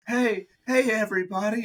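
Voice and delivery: put-on high, excited little-kid call; kid showing off. Toddler calling for everyone's attention, high and excited. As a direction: call out to the crowd like an eager toddler.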